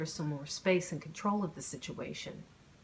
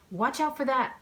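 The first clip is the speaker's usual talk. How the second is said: warning call; 8 semitones higher, 5 dB louder than usual. A narrator's reading. Warning him of obstacle.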